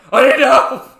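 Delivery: high, very loud overblown scream of help; hammed-up yell of I need help; mock shout. Mocking the all-caps quote to his cohost, hammed-up scream. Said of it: "He's not like".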